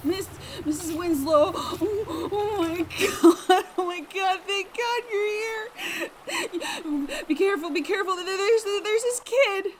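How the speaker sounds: high, tearful, frantic cries; cling to her in panic; terrified relief. Acted. Amanda crying out to her neighbour in terror.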